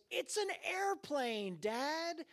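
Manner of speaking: put-on high, drawn-out child voice; slow, high-pitched kid explaining the obvious; indignant little boy. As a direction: imitate a small son setting dad straight. Little son corrects his father.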